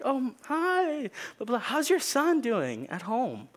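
high, slow, syrupy teacher; sweet teacher voice. Retold or imitated. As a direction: ask with a smile that means mischief.

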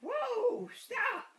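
high loud playful shout; hammed-up shout to stop the horse; whoa stop. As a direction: yell stop like the riders are slipping. Horse going too fast.

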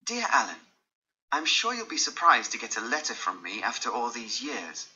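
ordinary delivery

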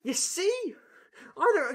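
very high, sly sing-song; high teasing flower voice. Acted. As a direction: coax like a sweet little flower.

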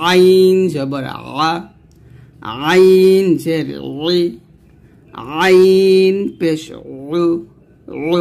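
আইন drawn out, squeezed from the throat; slow Arabic Ain drill on আইন; pressed Ain. Shown as a demonstration. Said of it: "এই যে চাপ দিন".

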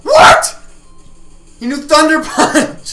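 high, loud shocked yell. Real, not acted. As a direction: shout it in disbelief. Shock at enemy move.

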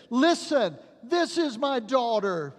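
panicked father yelling for help; desperate father. Retold or imitated. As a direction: shout it at the doctor, grabbing him. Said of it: "the father grabbed the doctor and said".

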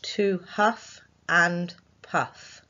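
"to huff and puff" very slow, word by word; slow dictation. Shown as a demonstration. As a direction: dictate each word slowly. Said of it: "the sentence we're going to write today".

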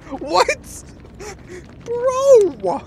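high loud shocked yelling; shocked shout. Real, not acted. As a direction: cry out in shock. Streamer shocked.

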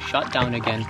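ordinary talk

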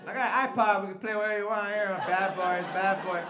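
high, loud silly coaxing; sing-song drunk sweet talk. Retold or imitated. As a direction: drunk man coaxing the officer into the car. Drunk sweet-talking cop.

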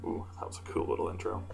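hushed impressed aside; whispered aside. Real, not acted. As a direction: drop to a whisper. Quiet aside after intro.

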